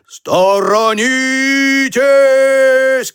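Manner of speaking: very long, high warning call; high, hugely drawn-out holler; boy shouting. A narrator's reading. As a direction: stretch the warning out like a siren. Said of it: "крикнул Васька".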